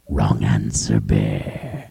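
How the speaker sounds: very deep slow lion; low menacing verdict; lion's verdict. Acted. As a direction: drop it low and final.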